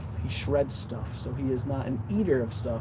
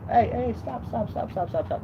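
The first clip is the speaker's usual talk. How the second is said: stopping the dog; 8 semitones higher than usual. Real, not acted. Stopping dog from burying bone.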